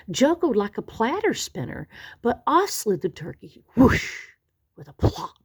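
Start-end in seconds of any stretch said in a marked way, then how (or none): none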